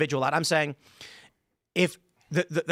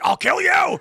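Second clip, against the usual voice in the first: high, loud mock threat; angry yell; 13 semitones higher, 7 dB louder, slower than usual. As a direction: bark a hammed-up threat. Imitating friend's overreaction.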